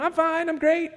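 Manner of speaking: mock cheerful. Mocking the usual polite lie.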